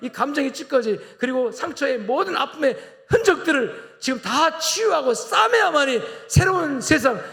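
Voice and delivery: high, loud, ringing pulpit cry; impassioned sermon climax; fervent preaching. Acted. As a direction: lift the congregation with a rising preacher's call. Preaching at full force.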